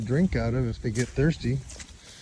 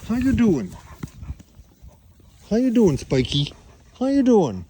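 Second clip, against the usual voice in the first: much higher, louder greeting to an animal; high pet-voice greeting; pet talk; 8 semitones higher, 5 dB louder than usual. Real, not acted. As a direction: sing it up high to a pet sheep. Greeting the ram Spike.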